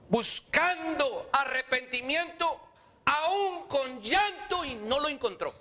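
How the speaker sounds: slow, high, ringing declamation; emphatic preaching. A narrator's reading. Preacher tells of Esau's failed repentance, emphatic preaching.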